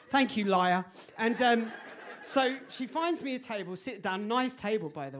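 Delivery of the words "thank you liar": sarcastic thanks. Retold or imitated.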